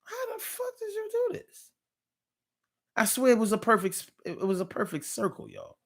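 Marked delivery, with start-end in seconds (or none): 0.0-1.7 retold amazement. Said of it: "I was like".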